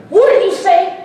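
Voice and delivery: high, loud offended challenge; mock indignant shout. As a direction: bark it back in fake outrage. Acted quote, indignant reply.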